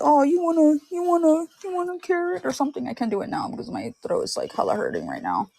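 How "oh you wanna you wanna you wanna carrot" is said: movie character. Acted. Fan attempting a Frozen line with a sore throat, high character voice. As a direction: attempt a high cartoon voice, trailing away. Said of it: "the part where he says".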